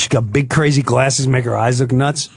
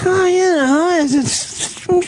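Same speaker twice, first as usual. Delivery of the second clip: high, hesitant squeaky waffling; comic high-pitched reluctant concession; mock hedging; 14 semitones higher than usual. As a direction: hem and haw way up high. Imitating himself wavering, thinking nurse justified.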